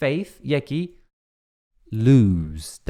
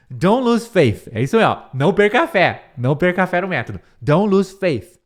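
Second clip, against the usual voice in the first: louder, higher rallying; rousing teacher push; emphatic encouragement; 4 semitones higher, 4 dB louder than usual. Real, not acted. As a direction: pump the students up. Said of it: "encouraging the class".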